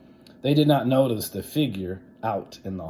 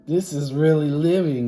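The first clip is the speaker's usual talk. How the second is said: put-on gleeful kids; 6 semitones higher, 8 dB louder than usual. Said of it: "they said".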